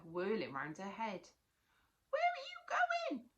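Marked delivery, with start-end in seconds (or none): 2.1-3.3 very high, louder squeak; tiny monster shouting. Acted. Tiny monster calling after Natalie, high squeaky shout. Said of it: "shouted the tiny monster".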